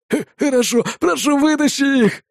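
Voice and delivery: high, stammering desperate plea; pleading. Acted. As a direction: beg for mercy in panic. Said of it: "взмолился шестой в рейтинге". (испуганная мольба)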